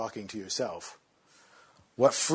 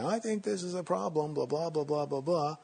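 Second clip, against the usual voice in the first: higher-pitched, prattling inner voice; chattering mind; 5 semitones higher than usual. Acted. The mind's self-talk going on to the meditator, mock chatter. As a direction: prattle on like a self-important nagging thought. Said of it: "which is your mind going".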